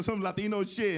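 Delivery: ordinary delivery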